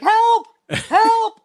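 high, loud retold screams for help; cries for help. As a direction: yell help, panicked. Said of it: "She's like".

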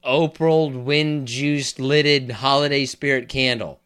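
loud, slow, drawn-out garble; mock tongue-twister attempt. Co-host mangling the candle's long name, loud drawn-out attempt. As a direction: stretch out each word loudly.

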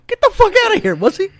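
mock disbelief. Hearing the XO was prior army: hammed-up disbelief. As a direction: squeal of retold amazement. Retold shocked reaction to news.

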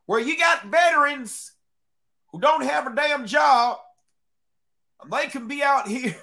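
put-on high, loud country-boy twang. Arguing for jobless veterans as guards, in a country voice. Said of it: "I gotta put my country voice on".

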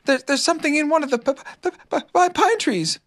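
high, stuttering little Piglet; frightened stammer. A narrator's reading. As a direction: stumble nervously on the first sounds, pitched high.

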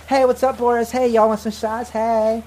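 put-on a breezy party-girl greeting; Valley Girl. Galina greets Boris with a tray, a hint of Valley Girl. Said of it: "She looks very Valley Girl".

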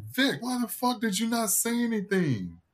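high-pitched mock indignant brother; angry brother. Brother angrily confronting him.

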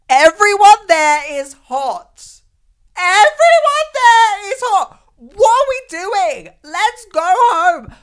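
very high, loud, drawn-out wail; mock meltdown. Model overwhelmed by hot people backstage, hysterical mock wail. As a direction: shriek it slowly in comic despair.

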